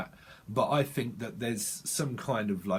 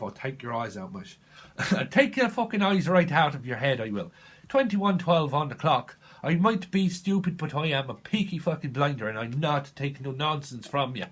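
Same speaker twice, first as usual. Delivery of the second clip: put-on Irish gangster accent; 2 semitones higher, 4 dB louder than usual. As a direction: play a Peaky Blinder with an Irish-sounding lilt. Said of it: "Whose accent does that come from?".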